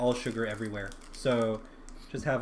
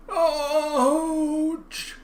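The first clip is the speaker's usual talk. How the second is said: river voice; 13 semitones higher, 5 dB louder than usual. Acted. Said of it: "a low bellowing voice".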